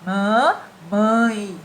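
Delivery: MAMÃE silabado, com ÃE saindo pelo nariz; som nasalizado. Shown as a demonstration. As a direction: falar "Mamãe" de nariz fechado, em duas sílabas. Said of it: "Fechem o nariz e falem mamãe". ("Mamãe" pausado e bem nasal)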